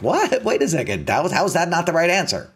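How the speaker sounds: high, fast, baffled protest; sudden squeak of disbelief, then rushing on; surprised protest. Retold or imitated. Novice umpire protesting to the master, high and baffled.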